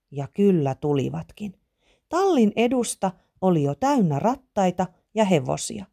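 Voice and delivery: plain reading voice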